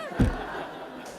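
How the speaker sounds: a short breathy burst of laughter; soft unvoiced chuckle; amused laugh. Real, not acted. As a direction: laugh at the cheeky reply. Preacher laughs at the answer.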